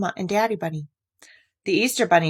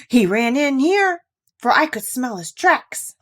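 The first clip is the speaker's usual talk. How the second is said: excited dog; 6 semitones higher, 4 dB louder, slower than usual. Acted. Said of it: "Fido cried".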